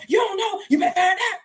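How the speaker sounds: high, loud mock guard yell. Guard yelling at the scared new inmate, high loud shout. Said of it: "everything is just yelling".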